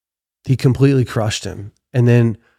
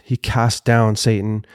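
normal talking voice

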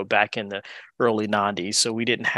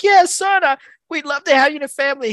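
imagined welcoming dad; 14 semitones higher, 5 dB louder, faster than usual. Retold or imitated. Imagined welcoming father in retold story.

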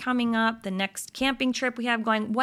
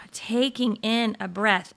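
long sigh then whispered words; deep breath in. Shown as a demonstration. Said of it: "we're not, like".

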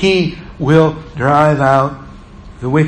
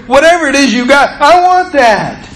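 higher, keen voice of people won over; eager onlookers; 7 semitones higher, 3 dB louder than usual. Retold or imitated. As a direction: sound like someone who wants in. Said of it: "people around you ... say".

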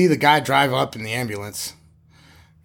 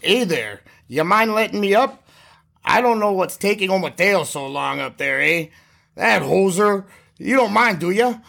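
higher, bouncy mock Canadian accent; thick Canadian accent; 5 semitones higher than usual. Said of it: "in this really thick Canadian accent".

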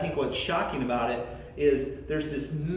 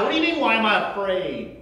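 higher, louder exasperated repeat; incredulous outburst; 6 semitones higher, 5 dB louder than usual. Retold or imitated. Preacher protesting the question from the sinking boat, louder. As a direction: throw the question back in disbelief.

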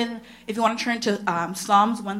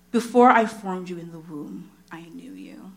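scripture reading; 5 semitones lower than usual. Acted.

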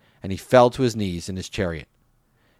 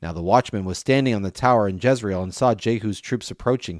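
ordinary delivery

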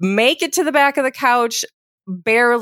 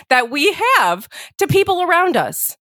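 ordinary talk, nothing marked

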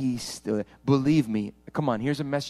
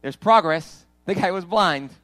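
preaching at full force; 7 semitones higher, 8 dB louder than usual. Real, not acted. Pastor voicing the struggling believer to his church, impassioned. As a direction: preach it out, stretching the words.

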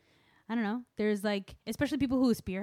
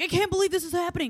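mock caller disbelief; 11 semitones higher, 6 dB louder, faster than usual. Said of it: "calling in on N P R like".

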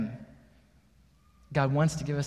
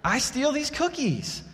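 put-on high, slow little-girl voice; stubborn four-year-old, much higher pitch; dead-serious little girl; 8 semitones higher, slower than usual. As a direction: play a small child utterly serious. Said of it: "with all the seriousness in the world".

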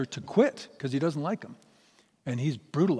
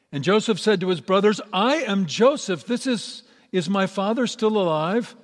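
higher and louder, voice lifting with feeling; emotional reveal; 6 semitones higher, 6 dB louder than usual. A narrator's reading. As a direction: Joseph tells his brothers who he is, higher and louder. Joseph reveals himself, emotional.

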